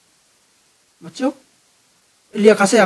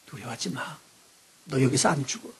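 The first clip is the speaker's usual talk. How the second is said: softer, low comforting God voice; tender reassurance; 3 semitones lower, 11 dB quieter than usual. Retold or imitated. God comforting the fallen John, soft and tender. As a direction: hush him gently, like calming a scared child. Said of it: "그러면서 뭐라고 그랬어요?".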